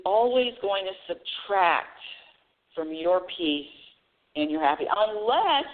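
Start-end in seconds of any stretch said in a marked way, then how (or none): none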